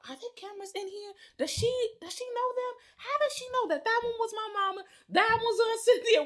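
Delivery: high, quick, incredulous questions; acted amazed questioning. Child recognizing her family in the paintings, amazed. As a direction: fire off high questions in mock astonishment.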